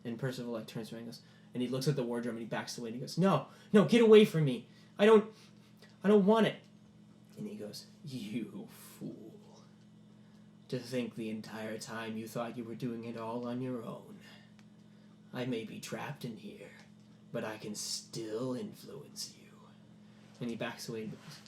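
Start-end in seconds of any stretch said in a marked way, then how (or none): 3.2-6.6 high, loud, frightened protest; panicked refusal. Acted. Percival backs away from the wardrobe, panicked. 8.0-19.5 soft, menacing, whispery voice; sinister trapped villain. Acted. Trapped creature tells Percival it still influences him, sinister.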